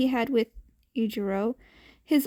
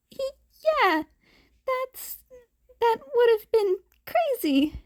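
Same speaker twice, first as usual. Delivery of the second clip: fake smile; 11 semitones higher than usual. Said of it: "said the boy with a smile, fake smile".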